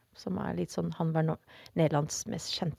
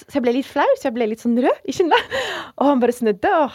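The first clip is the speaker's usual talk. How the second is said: ordinary delivery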